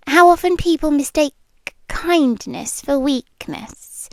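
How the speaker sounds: normal talking voice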